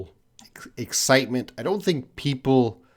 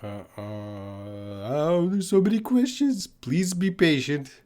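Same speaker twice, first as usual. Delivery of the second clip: mock-overwhelmed groan climbing into a high plea; drawn-out, high, play-helpless pleading; наигранно перегружен вопросами; 3 semitones higher than usual. Mock groaning over many questions.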